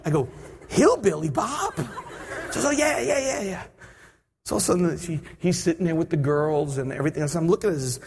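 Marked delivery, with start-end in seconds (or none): 0.7-1.7 high incredulous question; mock disbelief. Passenger to stewardess who wants his seatmate's picture, incredulous. 2.6-3.6 excited stewardess. Retold or imitated. Stewardess confirming whom she means, gushingly excited.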